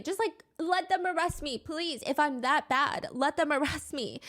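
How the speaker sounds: imitated desperate begging; retold plea.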